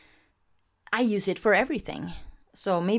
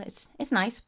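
ordinary talk, nothing marked

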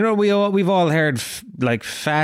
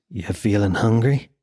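low, insinuating app voice; food app notification; 6 semitones lower than usual. Acted. Food app pestering a dieter on the phone, low and coaxing. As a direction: drop low like a tempter.

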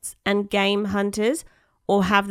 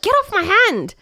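annoyed monkey voice; 12 semitones higher, 5 dB louder than usual. Retold or imitated.

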